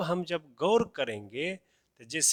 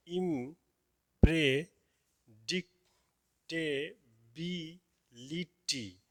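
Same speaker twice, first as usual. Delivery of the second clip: slow demonstration; much slower than usual. Shown as a demonstration. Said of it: "इस word को तोड़िए".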